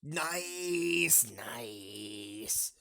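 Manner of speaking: stretched "nice", sinking to an unvoiced second one; long, fading mock approval; drawn-out "nice". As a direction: draw out "nice", then fade it to a whisper. Drawn-out reaction to failed sound.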